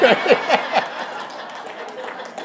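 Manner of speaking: high airy laugh with no voice; breathy laughter. Real, not acted. Laughing at his unprepared talk.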